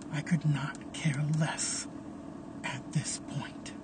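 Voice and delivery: flat, unvoiced whisper of indifference; cold whisper. Caleb after snapping Memphis's neck, whispered coldly. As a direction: breathe it out, uncaring.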